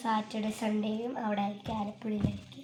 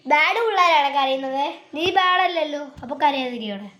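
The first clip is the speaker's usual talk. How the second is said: coaxing scold; 3 semitones higher, 8 dB louder than usual. Real, not acted. Big sister telling crying Nandu to stop. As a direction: scold the crier so he stops.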